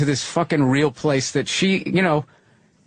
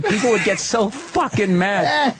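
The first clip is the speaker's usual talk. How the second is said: usual speaking voice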